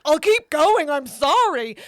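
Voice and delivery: loud mock-desperate pleading; retold apology. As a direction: blurt out an apology to the glaring instructor. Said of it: "And you're like".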